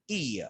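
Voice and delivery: "E" as a drawn-out ee; vowel sound. Shown as a demonstration. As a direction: say "E" as a long ee. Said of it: "O is pronounced as E".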